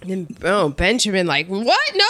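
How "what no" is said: hammed-up flustered outburst; mock freak-out. Benjamin asked about an affair by the clerk, mock freaking out. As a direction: imitate startled, spluttering denial. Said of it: "Benjamin completely freaks out".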